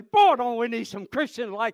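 normal talking voice

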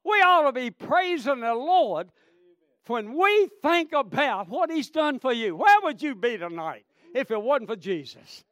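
loud, fervent revival preacher; full-force preaching. Real, not acted. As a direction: preach it out to the congregation.

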